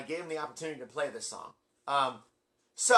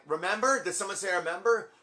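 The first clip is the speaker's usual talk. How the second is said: raised, louder questioning pickup; eager double-take; 5 semitones higher, 4 dB louder than usual. Retold or imitated. Singer checks a shouted request, raised eager questioning. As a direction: pounce on the request as if just overheard.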